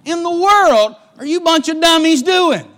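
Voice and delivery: high, loud scolding shout; scolding father. Acted. Jacob shouts down his starving sons for looking to each other. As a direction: berate the sons like an exasperated father. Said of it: "He says".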